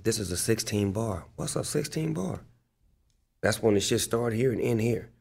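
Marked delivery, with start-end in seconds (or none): 1.4-2.4 higher-pitched, softer question; puzzled novice. Retold or imitated. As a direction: play the newcomer asking what that means. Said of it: "was giving me game on".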